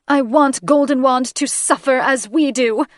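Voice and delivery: high, loud and bitter; vengeful fury. Acted. Grieving mother demanding the wizard suffer, high and loud. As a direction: spit out the wish for his suffering.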